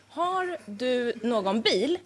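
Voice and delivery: slow, low and carefully spaced; measured, deliberate model question; demonstrated question. Shown as a demonstration. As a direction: spell it out word by word for the interviewer. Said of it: "fråga så här".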